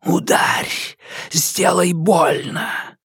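slow sinister hiss; hissing darkness. Acted. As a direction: whisper it like the dark. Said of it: "прошипела тьма".

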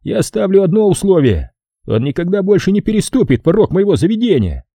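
high-pitched boss setting terms; Fowler the boss, stern. Acted. (строгий босс, высокий голос)

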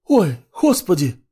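high, loud gasp of dismay; shocked exclamation. A narrator's reading. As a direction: cry out in shock. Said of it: "возглас Варвары".

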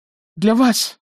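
high, loud, obliging creature voice; eager goblin. A narrator's reading. Goblin promises food to armed captors, eager. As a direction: pipe up high, eager to please.

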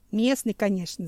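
ordinary delivery

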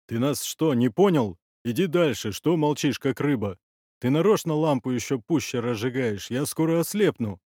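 loud, irritated questions; angry scolding. Acted. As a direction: berate the silent man with the lamp. Man berates silent lamp holder.

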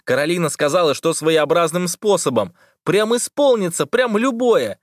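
high, gleeful, rising shout; excited boy. A narrator's reading. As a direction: blurt it out in triumph. Toha keeps exulting. (ликующий мальчишка)

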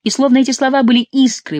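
plain reading voice

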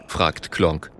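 plain reading voice

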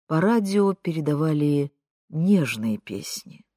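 ordinary delivery, nothing marked

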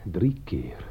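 breathless whisper counting the rings; whisper, afraid to break the silence; hushed disbelief at each ring; tense whisper. Acted.

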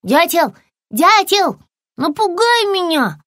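high, insistent calling; crying out. A narrator's reading. Lizard begs woodpecker to scare her, calling out high. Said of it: "закричала она".

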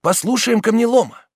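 loud, high call for attention; crowd call. A narrator's reading. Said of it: "раздалось в толпе".

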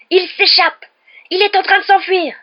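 furious roar. A narrator's reading. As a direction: bellow the alarm at full force. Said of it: "rugit l'oncle Vernon". (hurlement rugissant)

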